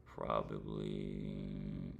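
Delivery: probably stretched very slowly, pitch dropping low; slow drawn-out probably. Real, not acted. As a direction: drag out the word probably, unsure. Hesitating before naming a day.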